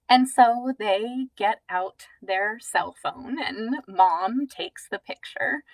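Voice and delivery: normal talking voice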